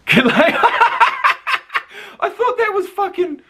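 helpless high-pitched laughter through the words; cracking up. Real, not acted. Owns up to mistaking the player, cracking up laughing. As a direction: laugh so hard the sentence squeaks out.

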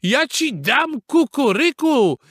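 high, mocking outburst; angry. A narrator's reading. Father beaver losing his temper at rooster, angry. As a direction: snap back furiously, mimicking the crow. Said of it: "rozzłościł się nie na żarty tata Bóbr".

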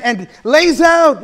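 "lays out" pushed out slowly and loudly; stress on lays out. Real, not acted. Preacher telling the congregation about expository preaching, shouting "lays out". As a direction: drive home "lays out" with a slow, loud shout.

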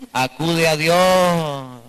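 drawn out very slowly and gently; slow emphatic advice. Shown as a demonstration. Preacher models the slow line to say to a neighbour. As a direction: lay it down slowly, one word at a time. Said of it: "Dígale a la mujer".

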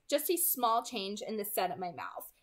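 plain reading voice

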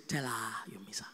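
soft unvoiced whisper. Holy Spirit prompts husband again, whisper. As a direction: breathe it as an inner voice.

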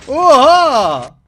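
loud startled exclamation; shocked shout. Real, not acted. Reacting to a slime clip, loud shocked gasp. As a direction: blurt it out in shock.

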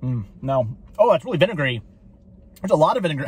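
eating between words; talking while chewing. Real, not acted. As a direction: mouth partly full of fry. Seen 1: Bit dipped waffle fry at -4; holds fry, grimaces, takes another bite.

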